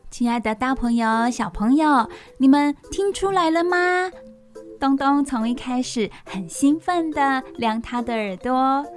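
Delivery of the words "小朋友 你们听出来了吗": ordinary talk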